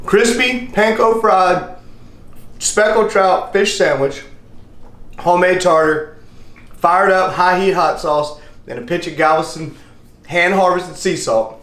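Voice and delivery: announcer style. Acted. Slow proud dish presentation.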